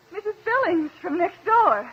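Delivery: loud announcement. Acted. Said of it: "Talk up good and loud".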